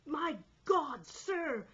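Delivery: high, loud exclamation; alarmed shout. A narrator's reading. Sailor to the second mate, alarmed shout. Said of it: "I shouted".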